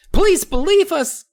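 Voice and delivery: tiny gnome begging; high gnome pleading. Acted. Gnome begging the kids to believe, very high and slow.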